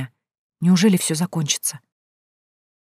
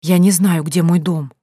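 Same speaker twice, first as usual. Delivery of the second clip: plain reading voice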